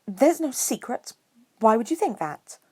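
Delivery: plain reading voice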